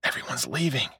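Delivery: soft, hushed voice; whispered. A narrator's reading. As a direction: say it under your breath. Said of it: "Jake whispered".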